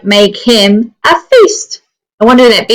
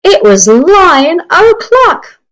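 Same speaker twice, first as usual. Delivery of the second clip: grand announcement; 10 semitones higher, 4 dB louder, slower than usual. Acted. Announcing lion's big moment.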